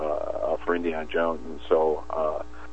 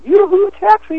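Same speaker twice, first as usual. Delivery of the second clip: very high, louder flirty call of yoo-hoo; cutie hailing a taxi; 7 dB louder than usual. Retold or imitated. Imitating the game's cutie hailing a taxi, very high and flirty. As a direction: sing out a coy yoo-hoo to hail the cab. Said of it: "She was the one who said".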